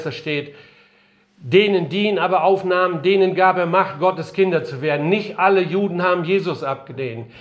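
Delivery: ordinary talk, nothing marked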